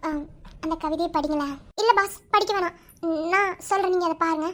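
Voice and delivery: ordinary delivery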